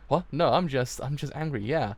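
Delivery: loud, hasty protest; flustered denial. Acted. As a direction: blurt out an embarrassed excuse, loudly.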